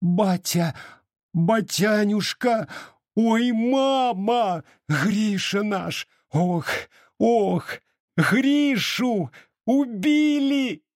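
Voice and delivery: very high, drawn-out wail of grief; anguished cry. A narrator's reading. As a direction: wail slowly, stretching the vowels high. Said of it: "крикнула дурным голосом". (истошный протяжный вопль горя)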